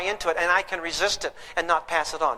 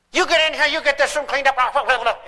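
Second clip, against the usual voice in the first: acting angry; 5 semitones higher than usual. Angry dad ordering the kids to clean up. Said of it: "you even act angry".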